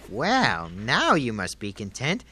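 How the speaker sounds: low weary sigh of a voice. Acted. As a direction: sink the voice down, tired.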